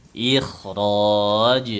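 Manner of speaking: "Ikhraj" chanted with a thick kh; "Ikhraj" slow and held, teacher's demonstration; correcting model. Shown as a demonstration. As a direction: draw out "Ikhraj", heavy on the kh.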